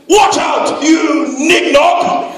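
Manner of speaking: high, loud slow yell. Retold or imitated. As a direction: bellow the insult. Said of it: "and I quote".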